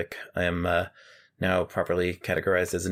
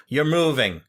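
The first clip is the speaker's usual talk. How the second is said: blunt grandma; 7 semitones higher, 5 dB louder than usual. Retold or imitated. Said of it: "Grandma Sylvia just goes".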